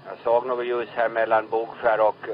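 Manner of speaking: ordinary talk, nothing marked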